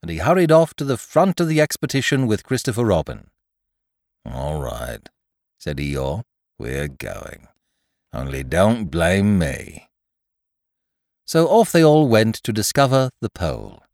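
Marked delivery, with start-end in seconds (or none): 4.2-5.1 low, soft, glum donkey; heavy-hearted quiet donkey voice; Eeyore, resigned. Acted. Eeyore gives in gloomily. 6.6-9.9 low, slow, glum donkey; resigned donkey disclaiming all responsibility; Eeyore, resigned. Acted. Eeyore gives in gloomily.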